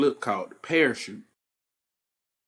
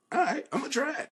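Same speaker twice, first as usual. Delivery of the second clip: psyched-up self; 13 semitones higher, 3 dB quieter, faster than usual. Retold or imitated. Younger self working up courage.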